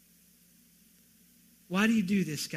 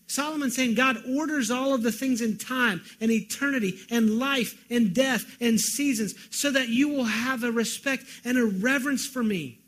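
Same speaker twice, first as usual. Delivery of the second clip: ordinary talk, nothing marked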